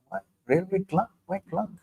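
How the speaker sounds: quietly amazed what, railway clerk; incredulous surprise. Retold or imitated. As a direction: retell your own disbelief at the answer. His shocked reply to the priest.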